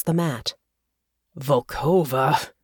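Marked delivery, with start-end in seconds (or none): 1.4-2.5 warning. A narrator's reading. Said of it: "in a warning tone".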